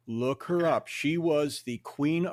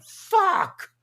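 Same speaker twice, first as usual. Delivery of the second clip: very high, loud exclamation; stunned exclamation; 10 dB louder than usual. Retold or imitated. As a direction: yell it out in shock.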